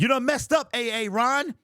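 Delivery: high, loud mock scolding; loud, high comedic scold; mock scolding catchphrase. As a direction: call him out like a teacher scolding a student. Quoting a famous comedy catchphrase.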